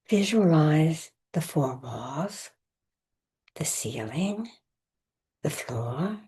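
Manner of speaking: measured meditation voice with long pauses; guided meditation. Acted. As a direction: guide listeners into rest, phrase by phrase, leaving space between. Leads a recorded Yoga Nidra session.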